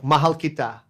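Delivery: "Mahal kita" modeled plainly in Tagalog; teacher's first take of "Mahal kita"; Tagalog pronunciation demo. Shown as a demonstration. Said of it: "in Tagalog".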